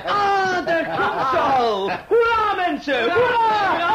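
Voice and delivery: high, loud cheer. Acted. Host greeting the bride's arrival with loud cheers. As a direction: hurrah at the top of the voice.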